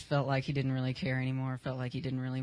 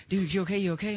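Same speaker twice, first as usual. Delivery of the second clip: higher, louder, urgent checking; imitated freaked-out concern; 5 semitones higher, 5 dB louder, faster than usual. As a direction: act out a worried double-check. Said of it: "He freaked out".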